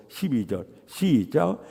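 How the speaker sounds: ordinary delivery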